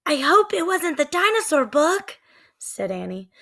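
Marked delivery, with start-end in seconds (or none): 0.1-2.2 put-on high, fretting girl's voice; worried little girl. Annie fearing the dinosaur book, high and fretful.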